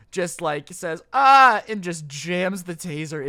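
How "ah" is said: battle yell. Retold or imitated. Kid jams taser into friend.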